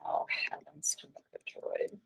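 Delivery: whispered aside to herself. Real, not acted. Teacher whispering to herself over stray annotations. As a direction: whisper it under your breath. Muttering to self, laptop trouble.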